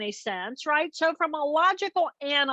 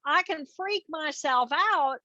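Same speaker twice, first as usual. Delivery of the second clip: usual speaking voice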